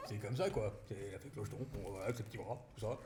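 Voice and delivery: a lightly done low fairy voice with a chuckle; mild fairy impression to scare his partner; fairy character voice. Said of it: "je lui fais peur en fée clocheton".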